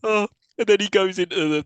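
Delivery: ordinary delivery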